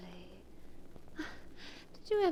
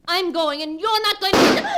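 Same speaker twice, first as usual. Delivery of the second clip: loud, high defiance cut off; wife defying the gun, then cut off screaming; defiant shout; 8 semitones higher, 10 dB louder than usual. Acted. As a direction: shout back at him fearlessly. Wife defies gun, then shot.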